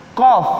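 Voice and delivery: "Kof" as a heavy qaf; qaf demo. Shown as a demonstration. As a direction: punch out "Kof" from far back.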